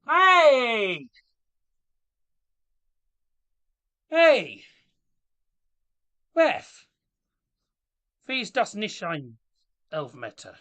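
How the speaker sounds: loud, high-pitched yell; shouting at the referee. Real, not acted. Fan yelling at the ref over a match, indignant shout. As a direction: holler at the ref in protest.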